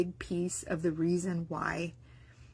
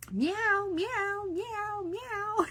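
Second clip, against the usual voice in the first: high kitty meows; 13 semitones higher, much slower than usual. Retold or imitated. Imitating baby following the kitty.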